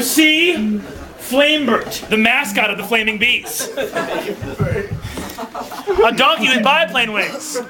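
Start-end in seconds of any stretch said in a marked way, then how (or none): none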